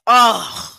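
loud groan of frustration; frustrated ugh. Real, not acted. Mad at the host over their arguments, loud fed-up groan. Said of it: "You be making me so mad".

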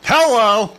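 bright shouted hello to fans; loud greeting. Pretend. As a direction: call out hello loudly. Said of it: "That's how we greet fans now".

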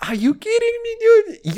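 high, incredulous cry of disbelief; can't believe what you're seeing; shocked disbelief. Real, not acted. Repeats the shocked outburst.

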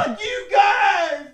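high, drawn-out yell as if from another room; mock tantrum. Acts a pissed kid offstage.

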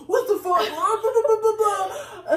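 high, loud mock tirade; imitated ranting. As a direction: rant into hammed-up angry gibberish. Re-enacting grandpa's ranting in public.

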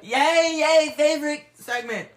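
high, loud, drawn-out yay; excited cheer. Real, not acted. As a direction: cheer it out for the favorite bit. Cheering for the segment.

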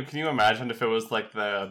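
usual speaking voice

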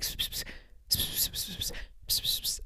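unvoiced psst psst gossip; mock whispering. Bouncers whispering about them in a corner, mock psst hissing. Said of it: "start whispering about us".

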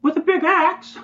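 very high, squeaky lady voice; village lady. Acted. Lady answering the child about the stranger, high lady voice. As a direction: pitch it way up like an alarmed villager. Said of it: "And then the lady says".

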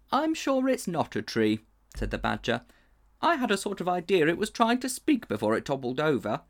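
a keen little badger, wondering. A narrator's reading. Badger arguing the uncle tried to speak.

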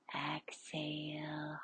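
ordinary talk, nothing marked

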